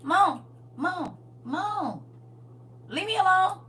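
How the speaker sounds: put-on high whiny child calling; a light child voice calling mom; imitating a child. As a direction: plead like a scared toddler. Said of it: "point me to the bathroom and say".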